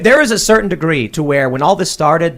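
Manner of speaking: normal talking voice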